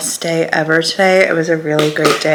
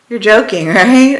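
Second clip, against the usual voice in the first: incredulous, laughing, a bit louder; laughing disbelief; 3 semitones higher, 6 dB louder than usual. Retold or imitated. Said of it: "And I'm like".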